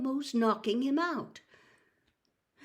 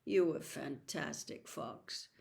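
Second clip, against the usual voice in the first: weak murmur; 6 semitones lower, 7 dB quieter than usual. A narrator's reading. Dying Harry thanks phoenix.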